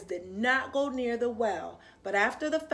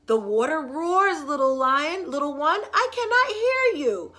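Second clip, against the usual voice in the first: louder call, rising at the end; calling back over water; 4 semitones higher, 7 dB louder than usual. Acted. Mother answering over the water.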